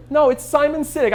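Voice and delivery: high-pitched attempted British accent; Simon Sinek. Caller insisting to a disbelieving listener, mock British accent. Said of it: "my attempt at a British accent".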